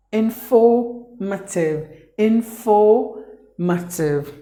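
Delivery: "informative" drawn out slowly, R silent; slow British "informative". Shown as a demonstration. Teacher modelling the British way to students, slow and careful. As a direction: spell it out slowly, no R sound. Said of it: "The letter R is silent".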